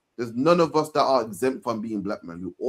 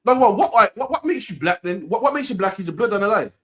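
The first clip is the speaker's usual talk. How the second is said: rising, emphatic questioning; heated; 7 semitones higher, 4 dB louder than usual. Real, not acted. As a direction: press the question, louder and higher.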